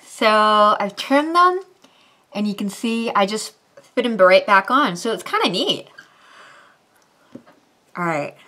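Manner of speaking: talking around fake teeth; slightly lisped speech; fangs in mouth. Real, not acted. Seen 0: Fake fangs on her teeth, pressed on while she speaks.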